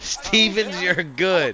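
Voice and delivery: mock suspect plea. Reactor mocking the arrested man's plea, loud imitation.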